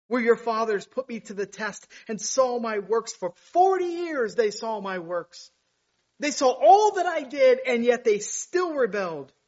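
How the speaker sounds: rising, loud and high; preaching at full force. Acted. Preacher reading God's rebuke to Israel, rising loud. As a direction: build it into a thunder.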